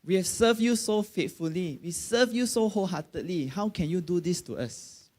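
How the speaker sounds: a preacher replays his hurt prayer to God, pleading; retold plea to God. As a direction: protest to God with a lift in the voice. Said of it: "I was even angry with God".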